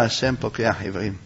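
low, soft, quick answer; calm rejoinder. Acted. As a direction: answer the threat quietly, unmoved. Joseph calmly answers the threat.

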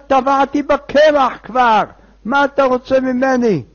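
high, loud complaint; exasperated protest. Acted. Woken sleeper protests to the man knocking, loud exasperation. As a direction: protest loudly at being woken.

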